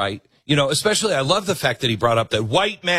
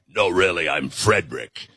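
much lower put-on man's voice; mock woman faking man; 8 semitones lower than usual. Woman pretending to be a man to vote, lowered mock voice. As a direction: drop deep to pass as Frederick. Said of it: "Nobody said".

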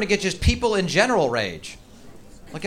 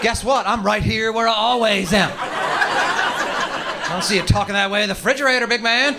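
higher, slower, taunting tough-guy voice; talking coffee table; 6 semitones higher, slower than usual. Pretend. Coffee table taunts the man who kicked it, higher and slower. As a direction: needle him like a smug bully. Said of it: "Think the coffee table's ever like".

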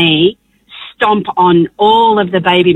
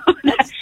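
short, soft, high laugh; small laugh at an awful memory; quiet real giggle; laughing at absurdity; 7 semitones higher, 6 dB quieter than usual. Real, not acted. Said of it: "I'm laughing now".

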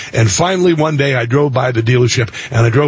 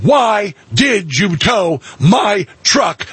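angry demand; 6 semitones higher, much slower than usual. Retold or imitated. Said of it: "I said".

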